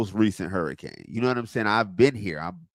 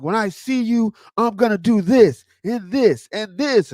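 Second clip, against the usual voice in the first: angry threat; 10 semitones higher, 6 dB louder than usual. Retold or imitated. Caller threatening her over the hurricane, mock angry. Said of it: "he was very angry".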